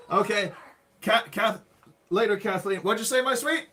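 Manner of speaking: loud, high call to another room. Real, not acted. Asking his sweetheart to repeat herself, calling out. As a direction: raise your voice, rising sharply at the end.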